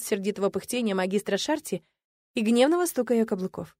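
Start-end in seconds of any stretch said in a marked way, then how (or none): none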